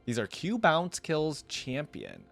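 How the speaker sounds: normal talking voice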